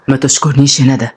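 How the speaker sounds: low, earnest male character voice; Odai, affectionate. Acted.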